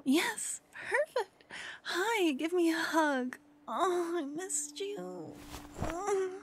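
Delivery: overjoyed to hug her lover again; joyful reunion. Acted.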